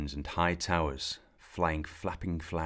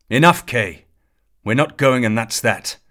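loud, higher shout ending it; furious shout; 6 semitones higher, 18 dB louder than usual. A narrator's reading. Sir Ector shouting down his son, loud and final. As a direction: bellow to end the argument.

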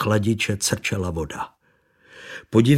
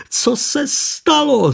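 high, slow, distant moan of shock; a woman dazed in a crash, wailing; 5 dB louder, slower than usual. A narrator's reading. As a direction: wail it out, dazed and frightened. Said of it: "zaúpěla žena na silnici".

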